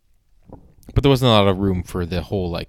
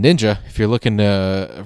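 ordinary talk

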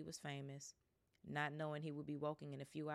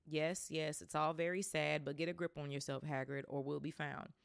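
usual speaking voice

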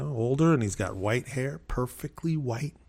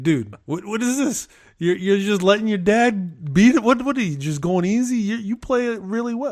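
higher, louder exasperated scolding; 9 semitones higher, 6 dB louder than usual. Retold or imitated. Scolding his neighbor for going easy on his dad, exasperated and high. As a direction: let the frustration spill out, voice climbing high. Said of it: "honestly mad at my neighbor".

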